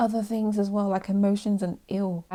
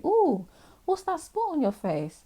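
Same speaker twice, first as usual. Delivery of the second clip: put-on high, nosy little kid; curious kid voice; 10 semitones higher, slower than usual. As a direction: coo it like a curious toddler. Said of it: "look at a kid and say".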